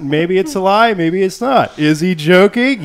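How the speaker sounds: high, loud, drawn-out teasing; mock-coy teasing. Host teases viewers about the promised dating sim, mock-coy. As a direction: play it up as a big, singsong mystery.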